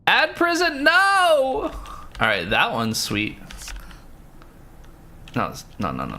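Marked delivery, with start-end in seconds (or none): 0.1-1.7 sudden very high yelp of protest; high-pitched outraged cry; shocked squeal. Real, not acted. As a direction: shriek it like the ad just ambushed you. Streamer reacts to ad interruption.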